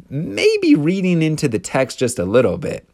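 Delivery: usual speaking voice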